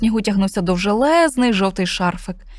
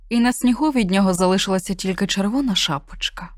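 ordinary delivery, nothing marked

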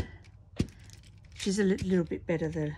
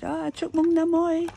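raised, lively retold greeting; 9 semitones higher, 9 dB louder than usual. Retelling greeting her brother on the phone. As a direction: greet him brightly in Vietnamese. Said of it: "I said".

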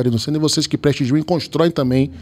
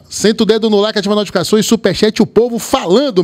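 hard sell ad; 6 semitones higher than usual. Acted. Plugs likes and superchats.

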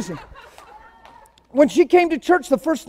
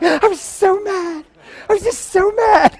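imitating sweet Tammy; 5 semitones higher than usual. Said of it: "She's like".

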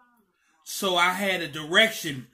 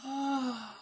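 relieved sigh; 4 semitones higher, 10 dB quieter than usual. Retold or imitated. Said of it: "she said".

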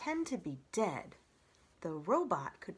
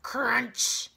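sudden loud, higher burst; crunching sound; 4 semitones higher, 8 dB louder than usual. A narrator's reading. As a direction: make the word crack like breaking shell. Imitates the eggshell breaking.

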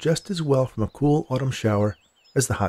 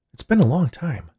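lightly voiced ailing grandpa, higher pitch; a hint of a weary old man, raised in pitch; 6 semitones higher than usual. A narrator's reading. Grandpa answers his granddaughter at his bedside, lightly voiced.

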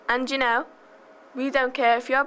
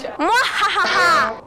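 slow booming villain cackle; evil cackle; 8 semitones higher, 6 dB louder, much slower than usual. Acted.

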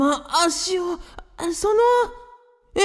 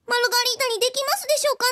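much higher, fast, squeaky put-on character voice; 10 semitones higher, much faster than usual. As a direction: jump way up into a quick, strange character voice. Margarita asks in her voice. (甲高く早口の作り声)

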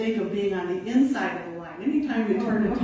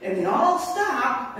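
ordinary delivery

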